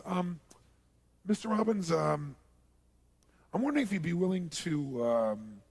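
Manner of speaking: halting request with long pauses and ums; tentative, as if hiding something; awkwardly hesitant. Acted. Sponsor inviting the speaker to dinner, uneasy and hesitant. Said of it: "the way they did".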